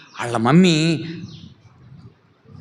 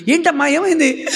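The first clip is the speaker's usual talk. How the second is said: high, worried asking voice; concerned neighbour; 12 semitones higher, 3 dB louder than usual. Acted. Neighbours asking the girl what happened. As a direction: ask with concern.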